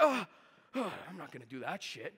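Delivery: laughing, dropping to a soft mumble; mock dodging. Coach mocking students dodging role play, laughing and quiet. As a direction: shrink away with a laugh. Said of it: "You guys are like".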